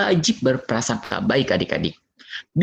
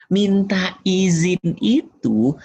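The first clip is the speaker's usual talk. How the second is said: ordinary talk, nothing marked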